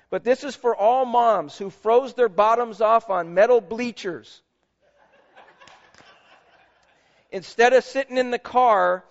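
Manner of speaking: sermon tribute read with lifted voice. Acted. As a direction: proclaim it to every mother in the room. Reads Mother's Day tribute.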